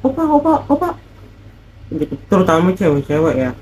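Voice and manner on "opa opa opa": high squealy "Opa, opa"; playful high-pitched greeting; imitating girls calling. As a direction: call out sweetly in a high voice like a fangirl. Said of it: "orang tuh sering nyapa".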